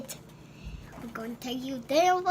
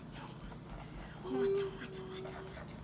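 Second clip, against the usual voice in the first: whispering; 3 semitones higher, 11 dB quieter than usual. Real, not acted. Child whispers hot repeatedly.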